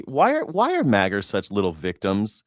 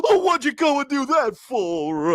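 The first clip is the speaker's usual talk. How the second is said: high, whimpering lion voice; cowardly lion; 13 semitones higher than usual. Retold or imitated. Mocking bullies as the cowardly lion, whiny. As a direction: whine like a scared cartoon lion. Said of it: "the cowardly lion".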